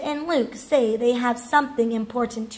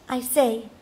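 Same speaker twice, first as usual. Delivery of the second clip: usual speaking voice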